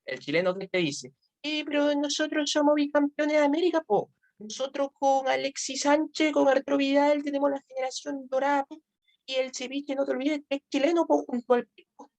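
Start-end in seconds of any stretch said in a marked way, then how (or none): 1.4-12.1 put-on high, quick Chilean accent with po; mock Chilean fan boasting, Chilean lilt. Teacher mimics a boastful Chilean football fan, Chilean accent. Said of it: "se le nota el dejo al toque".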